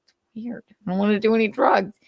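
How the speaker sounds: meek mocking whimper; mocking Aaron. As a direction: mumble it like a shy awkward kid. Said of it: "Aaron's like".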